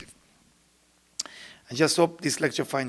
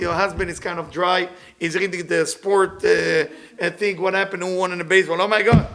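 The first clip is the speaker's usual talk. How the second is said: normal talking voice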